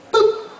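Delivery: high, short beep sound; imitated beep. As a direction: mimic the studio cue beep. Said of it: "you hear boop".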